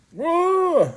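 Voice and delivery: a sudden high yelp of alarm; startled whoa. Pretend. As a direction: jump up in pitch, spooked. Imagined reaction to unsterile setup.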